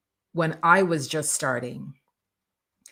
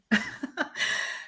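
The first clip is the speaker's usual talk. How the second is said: breathy unvoiced laugh; 10 semitones higher, 6 dB quieter than usual. Real, not acted. Laugh after own joke.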